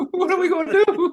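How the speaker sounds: high, loud wail of pretend dread; hammed-up panicking soldier, laughing through it; mock panic. Joining in as the helpless soldiers, mock panic. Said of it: "like, screaming, like".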